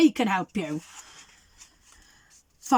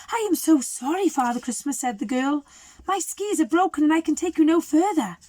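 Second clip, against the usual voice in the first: put-on higher, sorry girl's voice; pitched-up girl saying sorry; apologetic girl; 6 semitones higher than usual. The girl apologising to Father Christmas, pitched up.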